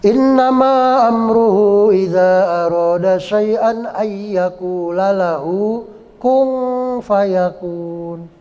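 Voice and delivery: slow sustained chant, veiled n in "kun fayakun"; Qur'anic ikhfa recitation. Shown as a demonstration. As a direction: hold the blurred nasal in KUN before fayakun. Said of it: "Itu bacanya samar".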